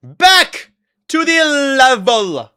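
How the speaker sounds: loud, high, drawn-out announcement; announcer-style call. Pretend. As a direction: belt it out slowly like a show host. Announces return to the game.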